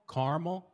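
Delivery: caramel as "KAR-muhl", loud and high; "ah" vowel in caramel, no middle syllable; two-syllable caramel. Shown as a demonstration. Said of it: "How many syllables?".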